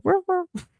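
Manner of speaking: high little yappy bark; dog bark imitation. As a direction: yip like a tiny dog. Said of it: "you hear Portia go".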